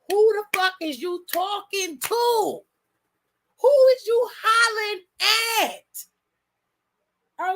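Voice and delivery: glare and demand it, rising into a holler; mock furious confrontation. Roman confronting Jey.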